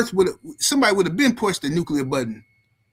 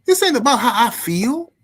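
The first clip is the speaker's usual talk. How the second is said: raised, high-pitched angry retort; snapping father; 10 semitones higher, 5 dB louder than usual. Retold or imitated. Sick father scolding his son about providing, snappish. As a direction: imitate a dad laying down the law. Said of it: "he snapped on me".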